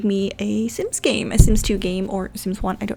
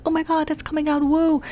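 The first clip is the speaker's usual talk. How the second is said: higher, gushing over a new release; excited gasp; 7 semitones higher than usual. Retold or imitated. Young fan reading the booklet, excited and high. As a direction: squeal over the coming pack. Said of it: "and be like".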